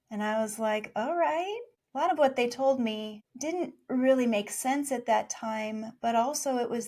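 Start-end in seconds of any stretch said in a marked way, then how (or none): 1.0-1.6 surprised okay. Retold or imitated. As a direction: squeak out a stunned okay. Child accepts being an angel.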